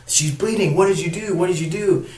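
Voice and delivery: imitating a distraught boyfriend, high and loud; mock outrage at the men who hurt her; upset. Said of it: "he was, like, really upset".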